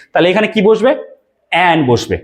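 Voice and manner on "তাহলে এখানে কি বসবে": usual speaking voice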